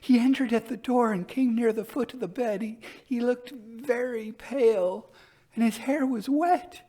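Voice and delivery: high, trembling, haunted telling; very wildly. A narrator's reading. Elder sister wildly tells her sister of the pale wet visitor. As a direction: describe the vision as if still seeing it. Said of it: "still very wildly".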